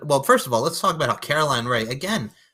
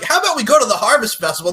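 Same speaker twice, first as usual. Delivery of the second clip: eager; 9 semitones higher, 10 dB louder, faster than usual. Retold or imitated. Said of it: "she's like".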